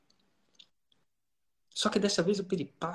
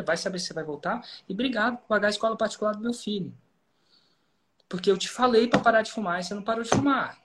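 higher and louder, biting mock gratitude; sarcastic scolding doctor; 5 semitones higher, 5 dB louder than usual. Doctor shaking up a patient who kept smoking, loud sarcastic scolding. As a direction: thank him loudly while shaking him up. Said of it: "não falou isso com a entonação que a maioria das pessoas falariam".

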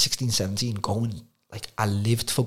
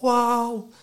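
high, loud mock wow; crowd amazed; 13 semitones higher, 5 dB louder than usual. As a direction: gasp it out like an awed crowd. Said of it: "Everyone's like".

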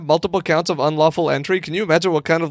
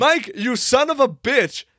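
slow, high-pitched exasperated cry; yelling; 8 semitones higher than usual. Real, not acted. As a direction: curse him out, drawn out and high. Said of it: "What are you yelling about?".

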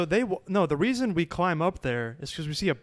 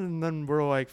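ordinary talk

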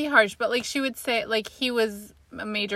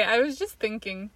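usual speaking voice

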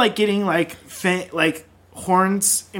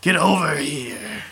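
commanding Shadow; slower than usual. Acted. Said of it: "Shadow commanded".

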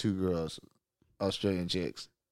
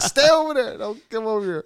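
very high, louder frightened plea to keep away; scared warning; 14 semitones higher, 4 dB louder than usual. Retold or imitated. Guest warns the roommate to keep his distance, high and scared. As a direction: keep the danger far off in a squeaky panic.

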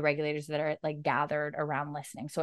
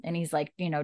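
usual speaking voice

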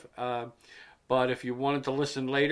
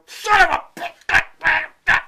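high, loud, slow drawn-out curse; frustrated curse; 9 dB louder, much slower than usual. Retold or imitated. The host retells cursing at autocorrect, slow and high. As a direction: stretch out the curse in exasperation. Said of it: "And I go back and go".

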